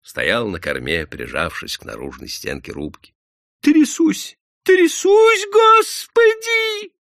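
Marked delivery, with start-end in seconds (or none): 3.6-6.9 very high, loud frightened squeal; испуганный писк. A narrator's reading. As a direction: squeal in fear, stretching the last word. Shura answers, shaking with fear. (плаксиво, в страхе)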